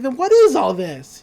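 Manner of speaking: loud, pushed demand; exasperated demand. Retold or imitated. Mabel confronts Dipper about the room, exasperated. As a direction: insist on an answer. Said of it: "And she's like".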